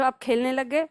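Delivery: ordinary delivery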